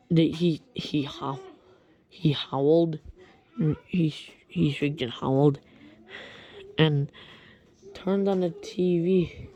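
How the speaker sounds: normal talking voice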